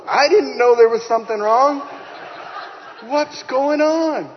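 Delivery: high, loud, breaking into a laugh; stunned and laughing, slowing on the question; laughing and crying. Retold or imitated. Husband hearing the pregnancy news, high and laughing. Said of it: "We're laughing and crying on the phone".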